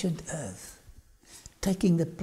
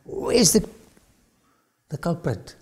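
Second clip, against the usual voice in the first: demanding questioner; 5 dB louder than usual. Retold or imitated. Officials question soldiers back without Jesus, demanding. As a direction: challenge the returning soldiers, opening with a burst. Superiors confront the returning soldiers.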